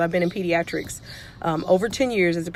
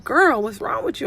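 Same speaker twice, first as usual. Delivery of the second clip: higher-pitched, teasing exasperation; mock scolding; 7 semitones higher than usual. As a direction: playfully call out a hyper kid. Playfully scolding her hyper kid.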